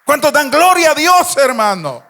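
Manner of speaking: loud chanted preaching over music; loud preacher chanting praise over music; full-force preaching. Acted. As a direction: lift the church with it.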